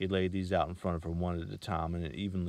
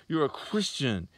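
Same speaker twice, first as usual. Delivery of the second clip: hissed; 8 semitones higher, 6 dB louder, slower than usual. A narrator's reading. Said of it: "she hissed".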